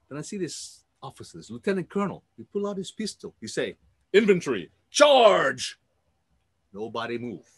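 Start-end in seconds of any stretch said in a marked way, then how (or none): 4.1-5.8 loud, high barked order to charge; rally troops with a shouted command; battle command. Acted. Lieutenant colonel orders the infantry forward, loud commanding shout.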